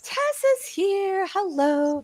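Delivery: high, drawn-out singsong welcome; excited greeting. Real, not acted. Host welcomes Tess to the stream, excited. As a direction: sing out the hello, high and slow. Greeting a newly arrived viewer.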